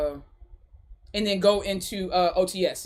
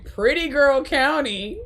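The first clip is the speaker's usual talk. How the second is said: laughing; 4 semitones higher, much slower than usual. Real, not acted. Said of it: "repeating the name laughing".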